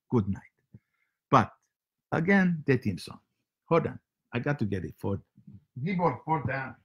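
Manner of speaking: comic foreign host with broken English; heavy comic accent, broken grammar. Acted. Comic foreign character Sergey hosting show.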